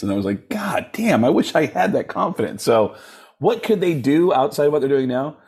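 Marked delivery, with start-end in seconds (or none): 0.5-2.6 higher-pitched retold amazement; mock awe. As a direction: exclaim in envious admiration, pitch jumping up. Quotes own amazed reaction.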